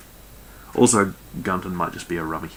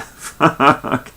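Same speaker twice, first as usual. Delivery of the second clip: amused swearing; 6 semitones higher, 3 dB louder than usual. Real, not acted. Reader stumbling over the captain's name, laughing curse.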